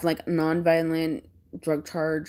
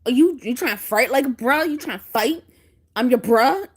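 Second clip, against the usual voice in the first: loud, higher-pitched pretend fight challenge; mock confrontation; 5 semitones higher, 5 dB louder than usual. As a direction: get in his face as a joke, questions pushed hard. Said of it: "I got all up in his face, and he thought I was serious".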